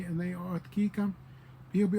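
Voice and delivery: ordinary delivery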